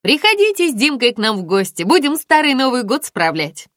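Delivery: loud, high, gleeful invitation; ringing with joy. A narrator's reading. Friend invites Aglaya over for Old New Year, overjoyed. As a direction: invite them with a ringing, joyful voice. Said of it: "звенел от радости".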